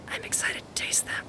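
hushed whisper. Real, not acted. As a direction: breathe it like a secret. Whispered exchange.

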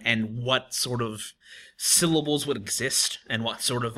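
ordinary talk

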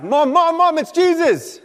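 high, loud squeal of recognition; thrilled child's high shout; excited shout. Acted. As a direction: call out to Mom, bursting with news. Unborn John excitedly recognises Jesus.

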